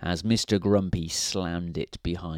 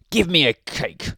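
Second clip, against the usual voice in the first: a higher, sharp snap at the baker; snapped; 6 semitones higher, 4 dB louder than usual. A narrator's reading. Mister Grumpy ordering Missus Fairy about, higher snap. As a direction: bark the order out. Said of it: "snapped Mister Grumpy".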